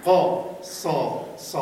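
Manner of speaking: قا، صا، صا broken apart very slowly and loudly; syllable by syllable. Shown as a demonstration. Teacher breaks the word into syllables, very slow and loud. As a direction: spell out قا صا slowly. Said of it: "بخونید".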